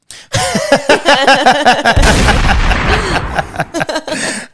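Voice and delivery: big bright burst of laughter; loud high laugh. Real, not acted. Laughs after hi mom.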